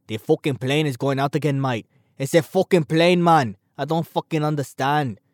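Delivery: badly faked Scottish or British accent; amateur attempt at a British-Scottish accent; terrible Scottish accent. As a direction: gripe in a clumsy mock-Scottish accent. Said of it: "doing a terrible Scottish or British accent".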